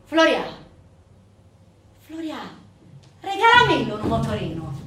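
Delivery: put-on loud, insistent girl calling out; pestering call. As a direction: call out and demand it. Teenage girl pestering her brother.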